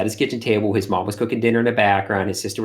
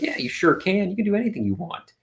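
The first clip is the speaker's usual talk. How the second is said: encouraging; 9 semitones higher than usual. Retold or imitated. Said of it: "I'm like".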